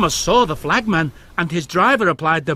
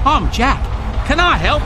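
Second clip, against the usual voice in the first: higher, louder keen offer; eager Jack; 8 semitones higher, 4 dB louder than usual. A narrator's reading. New loader Jack offers help to Oliver, keen and higher. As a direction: bounce up hoping to help. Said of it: "he asked".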